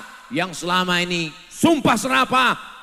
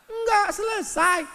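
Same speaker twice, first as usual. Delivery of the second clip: high emphatic answer. Acted. Emphatic answer to own question.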